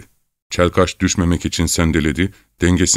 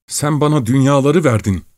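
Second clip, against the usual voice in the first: higher, gushing cry of thanks; grateful shout; 8 semitones higher than usual. A narrator's reading. Young peasant seizes the thief's hands, higher gushing cry. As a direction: cry out, overwhelmed with gratitude. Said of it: "diye bağırarak".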